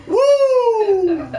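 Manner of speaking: high, loud whooping "woo"; Ric Flair. Retold or imitated. Drinker reacting to a strong first sip, wrestler-style whoop. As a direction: let out a long, piercing wrestler's whoop. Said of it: "Like Ric Flair and shit".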